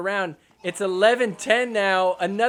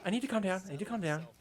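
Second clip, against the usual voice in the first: very soft muttered self-talk; mock self-calming; 11 dB quieter, much faster than usual. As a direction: repeat it quietly to settle yourself. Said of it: "immediately calm yourself down".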